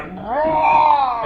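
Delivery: long high imitated roar; drawn-out mock battle roar; berserker roar.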